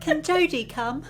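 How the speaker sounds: put-on high-pitched Cockney child; hammed-up Cockney child voice; bad Cockney accent. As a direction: ask like a little Cockney kid. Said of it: "really shit Cockney accents".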